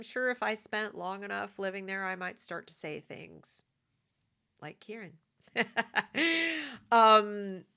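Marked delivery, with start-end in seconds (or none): 5.5-6.9 short laugh. Real, not acted. Laughs at her own example.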